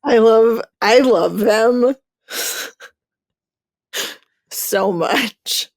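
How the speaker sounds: gushing. Real, not acted. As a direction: swoon over the couple. Gushing about the couple.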